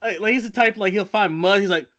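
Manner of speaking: ordinary talk, nothing marked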